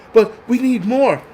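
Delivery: hammed-up pleading; mock whine. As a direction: whine it out like a spoiled kid.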